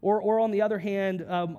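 ordinary talk, nothing marked

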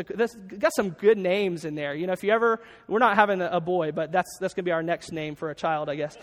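ordinary delivery

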